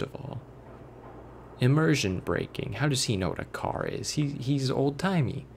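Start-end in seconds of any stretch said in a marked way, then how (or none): none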